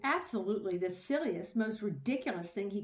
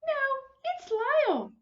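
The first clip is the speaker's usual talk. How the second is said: high, louder call; 6 dB louder than usual. Acted. Missus Prim calling out to the crowd that it's Lyle. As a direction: correct them with a call. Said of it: "called Missus Prim".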